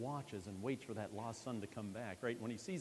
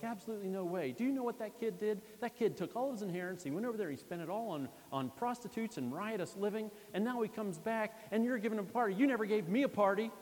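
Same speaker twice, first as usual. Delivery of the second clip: louder and higher, building to an indignant shout; resentful outburst; 12 semitones higher, 8 dB louder than usual. Acted. Older brother shouting at his father, refusing to join. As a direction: let the grievance boil over.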